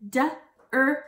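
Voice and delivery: "D-R-E-A-M" as separate speech sounds; "D-R-E-A-M" sound by sound, gaps between; word chopped into sounds. Shown as a demonstration. Teacher segmenting dream for kids, "D-R-E-A-M" chopped up. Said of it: "I'm going to chop up a word".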